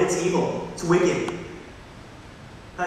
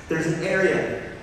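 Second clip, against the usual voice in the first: usual speaking voice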